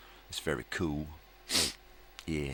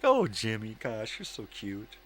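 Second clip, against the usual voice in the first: mock adoring fan; 7 semitones higher than usual. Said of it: "And people are just like".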